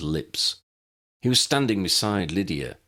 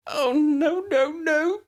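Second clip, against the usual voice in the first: very high, louder panicked wail; terrified cry; 3 dB louder, slower than usual. A narrator's reading. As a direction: shriek it out in horror. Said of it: "Lydia cried".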